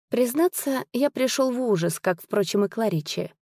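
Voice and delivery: plain reading voice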